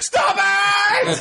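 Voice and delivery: escalating movie yell. Shown as a demonstration. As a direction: scream it like the movie's frantic finale. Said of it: "this is something I quote all the time".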